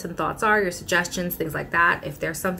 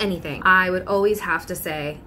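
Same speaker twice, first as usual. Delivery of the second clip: ordinary talk, nothing marked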